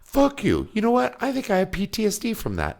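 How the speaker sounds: high-pitched, indignant imitated rant; mock outrage. Grown son blaming Dad for his PTSD, high mock outrage. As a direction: protest like an aggrieved grown son. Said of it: "Brad says".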